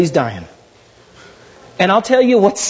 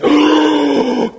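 big stunned intake of breath; sharp gasp; 6 semitones higher than usual. Shown as a demonstration. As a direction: gasp as if hearing shocking news. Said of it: "should have went".

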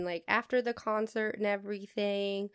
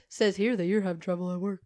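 fast, lower deadpan voice; mock psychic reading; 3 semitones lower, faster than usual. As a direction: read the card deadpan and quick. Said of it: "then she goes".

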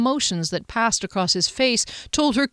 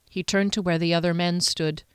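ordinary delivery, nothing marked

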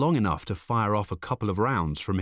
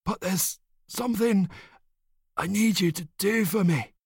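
dying man; 11 semitones higher than usual. A narrator's reading. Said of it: "the dying man says softly".

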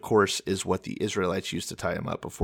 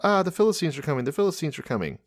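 quick, high-pitched warning; high, hurried cry without much volume; mock yelled alarm; 7 semitones higher, faster than usual. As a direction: pretend to raise the alarm. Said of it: "yells out".

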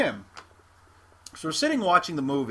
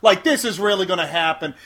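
loud sarcastic yell; screaming; 4 semitones higher, 9 dB louder than usual. Retold or imitated. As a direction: shout it across the theater. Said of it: "he stands up and screams".

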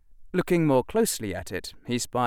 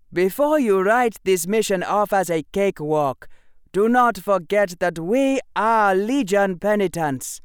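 loud, high-pitched commanding officer; commander addressing troops; 9 semitones higher, 6 dB louder than usual. Acted. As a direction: bark orders to the ranks. Commander gives orders.

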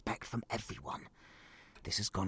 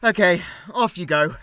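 much louder laughing relief; relieved; 9 semitones higher, 17 dB louder than usual. Acted. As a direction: shoo her off, giddy. Relieved parent dismissing the girl.

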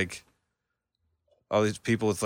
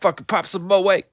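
high, loud mock narration of a friend's story; hyped storyteller imitation; 9 semitones higher, 4 dB louder than usual. Listener teasing the storyteller by mimicking him, hyped-up. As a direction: exaggerate a buddy's excited slangy storytelling. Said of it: "I love the way you narrated it".

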